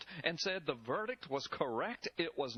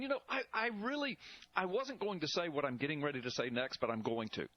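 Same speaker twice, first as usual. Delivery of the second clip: ordinary delivery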